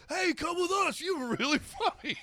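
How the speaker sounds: excited fans. Retold or imitated. Show fans inviting him along, high excited voice. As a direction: gush like a starstruck fan. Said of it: "people ... were just like".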